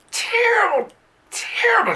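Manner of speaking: high squealed terrible, terrible; mock outraged squeak; hammed-up squeal. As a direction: squeak the word terrible up high for comic effect. Exaggerated repetition.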